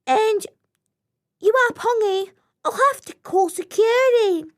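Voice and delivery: high, loud squeaky fish; tiny officious fish; small fish voice. Acted. Small fish threatens security.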